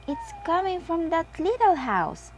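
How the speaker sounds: high, lightly voiced little sheep; young friend points out the buzzing house; Susie voice. A narrator's reading. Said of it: "says Susie".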